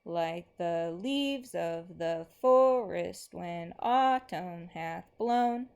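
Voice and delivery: slow, lilting recitation that rises on each line end; slow poem reading. Acted. Teacher reads the next poem to the class, slow and rhythmic. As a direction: chant it gently in its galloping rhythm.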